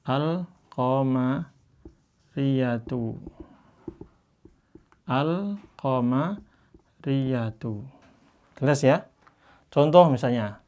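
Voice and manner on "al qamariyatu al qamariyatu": al-qamariyatu drawn out very slowly and softly; Quranic recitation. Shown as a demonstration. Teacher showing students how to read al-qamariyatu, slow recitation. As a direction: chant al-qamariyatu like Quran reading. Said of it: "kayak baca".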